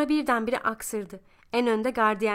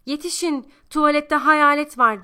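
loud, higher cry for help; frightened shout; 5 semitones higher, 8 dB louder than usual. A narrator's reading. Ayten screams to the ward about a ghost, loud alarm. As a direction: yell out in a panic. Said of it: "diye bağırdı".

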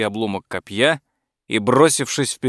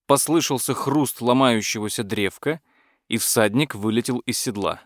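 usual speaking voice

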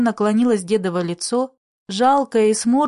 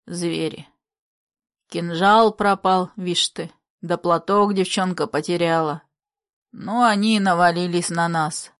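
put-on creaky old man's voice; 4 semitones lower than usual. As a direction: lament like a worn old man. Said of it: "Скрипучий голос деда".

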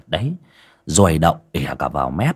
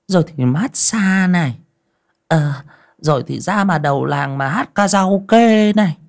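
nagging wife; 11 semitones higher than usual. A narrator's reading. Wife scolding her bedridden husband, high nagging voice. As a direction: nag him like a fed-up old wife.

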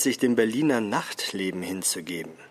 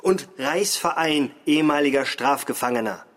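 mocking correction; 5 semitones higher, 4 dB louder, slower than usual. Examiner corrects her with a laugh.

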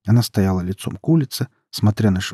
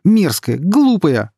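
loud, high outburst of outrage; wounded, furious yelling; outraged yelling; 6 semitones higher, 5 dB louder than usual. A narrator's reading. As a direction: yell it, climbing higher with each insult. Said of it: "завопил Май".

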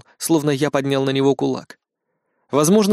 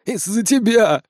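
muffled accusation; 6 semitones higher, slower than usual. Acted. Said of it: "более приглушённым голосом".

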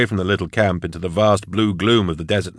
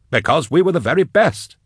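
high, exuberant exclamation; exclaiming; 9 semitones higher than usual. A narrator's reading. Endor boasting to Eisenhorn, an exuberant exclamation. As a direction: pitch it up high and boast merrily. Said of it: "Endor exclaimed".

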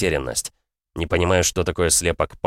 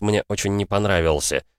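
ordinary delivery

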